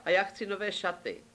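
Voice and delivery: put-on high girlish princess voice; demanding princess. Princess adding her wish after the king's, high-pitched.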